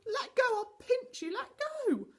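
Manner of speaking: high, loud furious scream. A narrator's reading. Connie screams at Moonface holding her ankle, high and loud. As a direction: scream at him to let go. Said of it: "she screamed".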